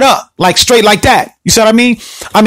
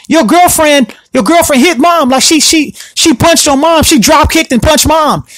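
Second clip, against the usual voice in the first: higher-pitched, worked-up sister act; imitated agitated tattling; 7 semitones higher than usual. Sister telling the boyfriend about mom, mock agitated. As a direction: act out an urgent accusation. Sister reporting fight to brother.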